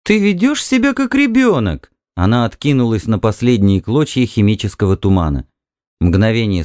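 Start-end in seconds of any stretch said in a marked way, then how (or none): 0.0-1.9 high-pitched reproachful wife; wife's voice, reproachful. A narrator's reading. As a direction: play an upset wife, pitched well up. (высокий женский голос, с укором)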